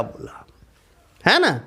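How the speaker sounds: ordinary delivery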